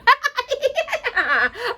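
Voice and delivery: high, shrieking fairy laughter; giddy fairies laughing after sixteen years. Acted. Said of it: "they were shrieking with laughter".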